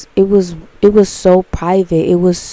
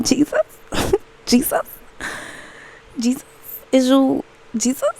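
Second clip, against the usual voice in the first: high, breathless, giggly disbelief; laughing awe; 7 semitones higher, 4 dB quieter than usual. Retold or imitated. Asking Jesus if it's him, high laughing awe. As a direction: gasp his name in amazed wonder. Awed at waltzing across ocean.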